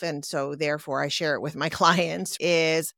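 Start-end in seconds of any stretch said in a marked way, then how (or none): none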